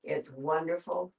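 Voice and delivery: ordinary talk, nothing marked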